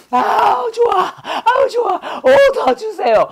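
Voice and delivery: very high hammed-up squeal of delight; mock armpit-sniffing ecstasy. Teacher pretending to sniff armpit hair and love it, mock ecstatic squeal. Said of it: "겨털 냄새 맡".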